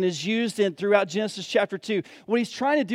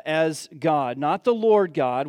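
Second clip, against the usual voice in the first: normal talking voice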